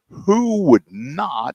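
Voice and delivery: high and very slow, in awe; amazed. Retold or imitated. Buyer marveling at the fancy delivery, amazed. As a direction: stretch it out in wonder. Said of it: "And I'm going".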